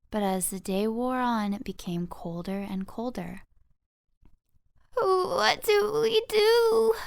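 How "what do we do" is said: very high, slow, shivering squeak; cold, frightened mice. Acted. Freezing mice panicking in the snow, high and shivering. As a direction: quake with cold and fear. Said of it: "cried the mice through chattering teeth".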